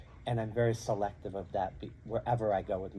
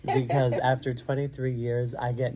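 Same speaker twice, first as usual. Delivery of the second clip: laughing; 3 semitones higher, 9 dB louder than usual. Real, not acted. Laughs while admitting nerves.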